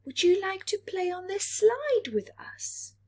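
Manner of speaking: high, bright invitation; little Mars inviting Little Earth to play; cheerful planet friends. Acted. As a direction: call him out to play.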